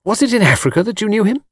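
high, eager feminine question; inquisitive admirer. A narrator's reading. Miss Taylor asking about Africa, inquisitive. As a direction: ask with keen interest. Admirer quizzing Mr Cade, acted.

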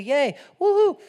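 high, loud crowd cheer; mock cheering. Preacher acts out crowd welcoming Jesus, mock cheering.

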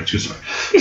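breathed out as a whisper; a teasing whispered aside. Shown as a demonstration. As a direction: drop to a secret whisper. Repeats the aside under his breath.

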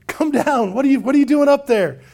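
calling up a tree. Acted. Jesus calls up to Zacchaeus.

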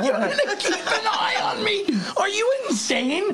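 very high, laughing incredulous shout; laughing disbelief. Retold or imitated. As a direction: howl it in stunned disbelief. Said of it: "I'm like".

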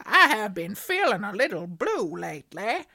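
put-on cheerfully overdone American accent. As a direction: read the letter in a hammed-up American accent. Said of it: "maybe stop doing the accent".

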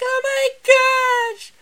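very high, slow, breathless "oh my gosh"; mock swooning girl voice. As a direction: gush like a smitten fan. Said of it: "And the girl's like".

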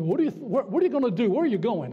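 mock-angry, sputtering challenge; mad preacher. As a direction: imitate a preacher demanding answers from a walkout. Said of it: "it made the preacher mad".

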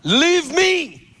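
high booming command from heaven; preacher shows the divine voice, high and ringing; loud heavenly voice. Shown as a demonstration. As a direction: call it out high like a heavenly figure. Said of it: "Just loud".

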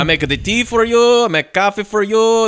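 hammed-up French seducer offering everything; terrible French accent. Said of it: "doing this terrible French accent".

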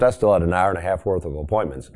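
normal talking voice

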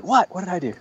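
high, startled "who, me?"; bewildered protest. Acted. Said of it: "Lowell looks up like".

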